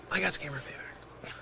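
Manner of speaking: soft, breath-only disbelief; hushed shell-shocked murmur; stunned whisper. Acted. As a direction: whisper it to yourself, defeated. Stunned mutter to himself.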